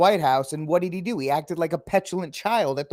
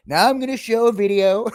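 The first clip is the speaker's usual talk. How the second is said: higher, louder mock Trump announcement; 7 semitones higher, 5 dB louder than usual. Host imagining Trump showing Putin a video, pitched-up showman. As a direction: play it like a proud showman, pitched up. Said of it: "if he's like".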